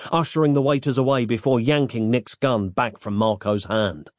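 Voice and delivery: ordinary delivery, nothing marked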